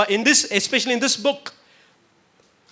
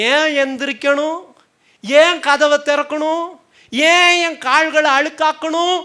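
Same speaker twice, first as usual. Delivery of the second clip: high, raised-voice protesting questions; defiant refusal; 8 semitones higher, 7 dB louder than usual. Acted. Church refuses to get up for God, high protesting refusal. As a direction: act out a reluctant, complaining sleeper.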